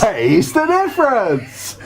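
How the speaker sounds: put-on slow, high, drawn-out Yorkshire accent. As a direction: stretch it out in broad Yorkshire. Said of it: "How can you do a Yorkshire accent better than me?".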